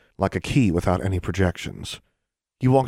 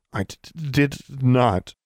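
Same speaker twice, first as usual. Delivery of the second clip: higher, stuttering cold denial; stammering; 6 semitones higher, slower than usual. Acted. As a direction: stutter it through shivering. Shivering girl stammers denial.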